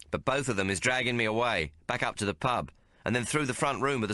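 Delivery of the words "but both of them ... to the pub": plain reading voice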